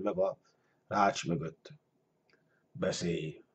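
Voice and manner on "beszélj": low, very soft command; growling dragon. A narrator's reading. Great dragon orders the man to speak, growling low. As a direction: growl it low, barely voiced. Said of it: "morgott a nagy sárkány".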